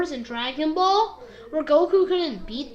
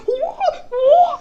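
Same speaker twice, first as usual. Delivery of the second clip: high, loud wailing whoas; mock alarmed whoa; 11 semitones higher, 5 dB louder than usual. Frost is pulled into the trap, high mock wail. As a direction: cry out as if being sucked away. Imitates Frost being sucked in.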